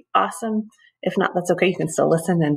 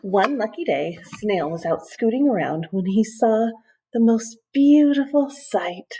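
plain reading voice

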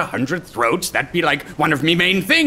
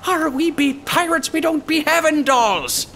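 much higher, exasperated pirate bark; 12 semitones higher than usual. Acted. Captain telling the crying girl pirates have no dolls, exasperated. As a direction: scold a crying toddler, fed up.

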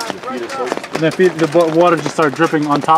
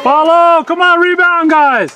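very high, loud urgent shout; yelling from the sidelines; 7 dB louder, slower than usual. Real, not acted. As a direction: call the team onto the rebound. Parent urging rebound from sideline.

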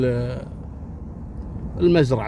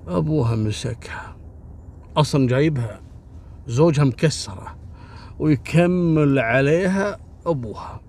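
usual speaking voice

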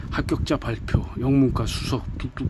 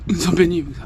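calling out; 5 semitones higher, 5 dB louder than usual. Acted. Calling out while running to him.

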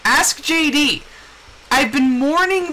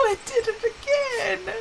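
laughing disbelief; 10 semitones higher, 5 dB quieter than usual. Real, not acted.

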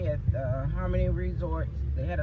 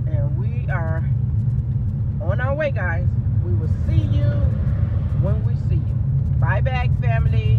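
loud sign-off; 5 semitones higher, 8 dB louder than usual. Real, not acted.

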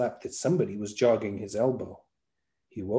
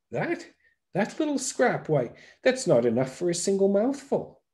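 scoffing eater; 8 semitones higher than usual. A narrator's reading. Eater scoffs at his sack.

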